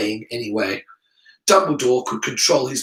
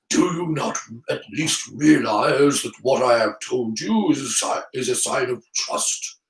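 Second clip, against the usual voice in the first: put-on old wizard voice, mildly hurt; wounded Dumbledore; 3 semitones lower than usual. Dumbledore appealing to Harry's trust, mildly wounded. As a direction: reproach gently as an old headmaster.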